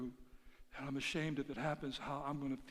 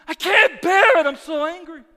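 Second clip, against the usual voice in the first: loud, high cry of rage; acted angry outcry; 14 semitones higher, 22 dB louder than usual. Believer unloading rage on the Father, acted angry outcry. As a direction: cry out as if it is unbearable.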